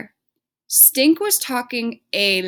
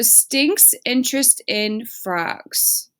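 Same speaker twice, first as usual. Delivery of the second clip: slow, deliberate modeling voice; slow and clear; much slower than usual. Shown as a demonstration. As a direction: spell it out slowly for students. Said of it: "use that as our sentence starter".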